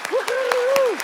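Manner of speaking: high, gleeful woo-hoo; excited cheer. Real, not acted. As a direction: cheer it out in delight. End of round cheer.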